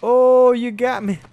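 loud high yelp of surprise; sudden shout on getting shot; startled shout. Real, not acted. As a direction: cry out as you get caught. Just got killed in game.

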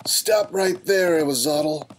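slow, high, loud commanding shout. Acted. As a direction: bellow it down from above. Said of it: "came a shout from above".

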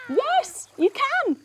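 high-pitched squeal of delight, drawn out; slow, high, gleeful cheer; delighted reply. Pretend. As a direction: play thrilled on a pretend phone call. Friend accepts on pretend phone call.

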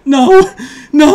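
high, loud cries of no; loud protest. Real, not acted. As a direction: protest at the cards, laughing. Dreaded card about to come.

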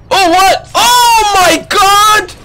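high, loud disbelieving yell; excited shout. Real, not acted. Streamer to chat, thrilled by a play, shouting high. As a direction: yell it out, stretching the oh my God. Wild shootout win in game.

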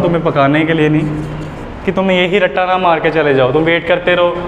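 ordinary talk

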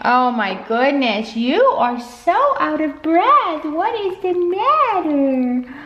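high, slow cooing at a panting puppy; sweet high pet voice. Real, not acted. Baby talk to panting dog.

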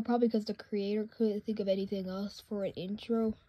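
normal talking voice

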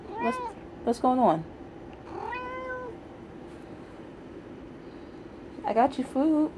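high, soft pet talk. Real, not acted. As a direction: coo at the cat. Soothing the cat.

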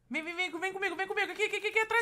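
high, breathless rush of repeats; panicked urging. Real, not acted. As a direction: urge a partner along in alarm. Guiding partner while fleeing. (apressando, aflito)

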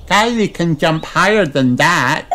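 high little piglet voice; Peppa. A narrator's reading. Peppa suggests a game to George, high-voiced. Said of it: "says Peppa".

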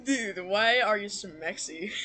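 usual speaking voice

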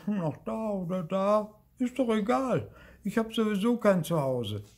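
put-on high-pitched, huffy ghost girl; defiant little ghost. Homeless Luzi answers Marti, huffy and high. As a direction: brush off the question in a high voice.

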